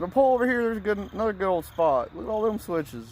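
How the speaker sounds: high, loud, jokey tease; mock teasing. Joking with niece about switches, high teasing call.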